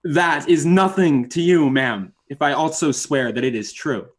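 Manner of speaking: ordinary delivery, nothing marked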